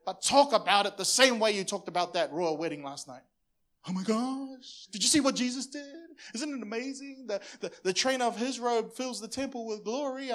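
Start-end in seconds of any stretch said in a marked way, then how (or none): 3.9-10.3 higher, breathless gushing gossip; gushing excitement. Pretend. Pastor imitating churchgoers gushing about Jesus, excited higher voice. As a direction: gush like fans after a royal wedding.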